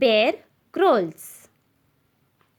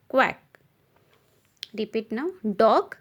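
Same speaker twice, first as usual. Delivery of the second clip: ordinary talk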